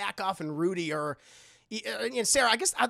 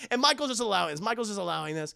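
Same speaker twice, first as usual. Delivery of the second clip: usual speaking voice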